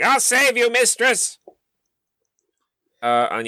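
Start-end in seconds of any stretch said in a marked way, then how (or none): 0.0-1.4 high, heroic rescue cry; hammy stage actor. Acted. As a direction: shout it like a loyal champion.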